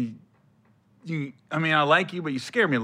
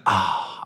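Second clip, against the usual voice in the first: usual speaking voice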